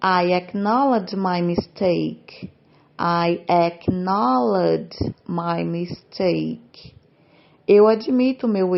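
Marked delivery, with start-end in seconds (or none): 0.0-2.5 slow, clear teacher read of "I acknowledge my mistake"; measured classroom pace; slow model reading. Shown as a demonstration. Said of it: "Próximo exemplo". 3.0-7.0 deliberate syllable-by-syllable demo; very slow model reading. Shown as a demonstration. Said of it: "Próximo exemplo".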